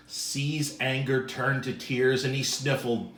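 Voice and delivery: ordinary delivery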